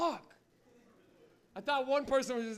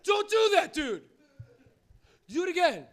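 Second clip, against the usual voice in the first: high, loud stunned reaction; acted astonished friend; amazed outburst; 10 semitones higher, 11 dB louder, slower than usual. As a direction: shout it in disbelief, slowly punched.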